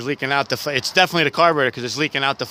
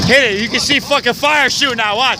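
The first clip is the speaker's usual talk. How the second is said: shouting over engine; 9 semitones higher, 7 dB louder than usual. Real, not acted. Shouting over roaring boat engine.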